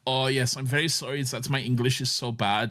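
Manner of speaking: apologetic German speaking English; precise, over-correct English with a German accent. Retold or imitated. German apologising for supposedly bad English, imitated German accent. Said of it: "a German speaking English".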